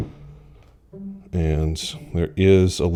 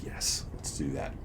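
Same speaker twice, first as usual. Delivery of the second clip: whispered; 3 semitones lower, 14 dB quieter than usual. Retold or imitated. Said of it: "I'd be like".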